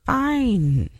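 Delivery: usual speaking voice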